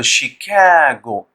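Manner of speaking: "शिकागो" slow, with a long stressed middle syllable; model pronunciation. Shown as a demonstration. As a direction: read out "शिकागो" slowly, leaning on the middle. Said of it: "इसको पढ़ेंगे आप".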